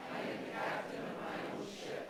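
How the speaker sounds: faint congregation repeating from afar; distant group recitation; group echo. Real, not acted. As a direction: murmur it back as a crowd. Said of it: "you can repeat it together".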